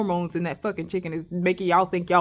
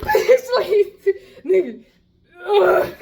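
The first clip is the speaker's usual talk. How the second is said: higher, louder through real laughter; breaking up with laughter mid-word; words squeezed out between real laughs; laughing; 11 semitones higher, 4 dB louder, much slower than usual. Real, not acted. Cracking up retelling the fight.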